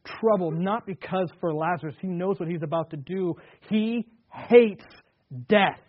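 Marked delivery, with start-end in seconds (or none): 3.7-5.8 emphatic preaching. Real, not acted. Preacher emphasising Jesus' hatred.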